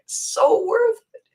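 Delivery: high, slow, choked-up repeat; voice breaking. Real, not acted. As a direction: let the voice crack with emotion. Emotional retelling, voice breaks.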